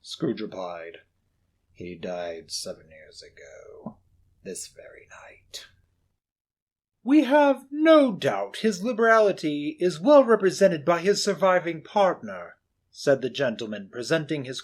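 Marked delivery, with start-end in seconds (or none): 1.7-5.6 low, slow, hushed reply; cold old miser. Acted. Scrooge telling the gentleman of Marley, low and cold. 7.0-12.6 hearty gentleman. Acted. Portly gentleman greeting Scrooge, booming.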